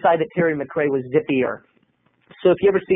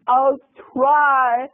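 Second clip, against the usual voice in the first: high, tearful mock whimper; Marsha Brady; 8 semitones higher than usual. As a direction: answer as if just hit on the nose. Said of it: "like Marsha Brady when the football".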